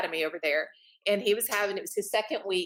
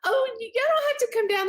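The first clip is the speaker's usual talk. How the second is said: much higher, half-laughing fluster; flustered mom; 13 semitones higher, 3 dB louder than usual. Retold or imitated. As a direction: squeak it out embarrassed. Flustered mom shooing kids' friends.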